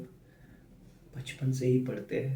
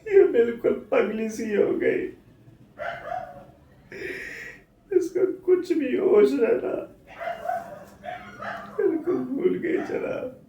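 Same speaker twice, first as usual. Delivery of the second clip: high, loud, tearful wail; anguished crying; 10 semitones higher, 9 dB louder than usual. Acted. Grieving husband describing his wife's collapse, wailing tearfully.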